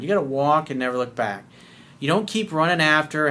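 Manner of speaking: usual speaking voice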